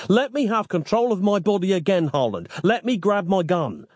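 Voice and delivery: high, exasperated demand; snapped. A narrator's reading. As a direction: insist on it, fed up. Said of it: "snapped Nick".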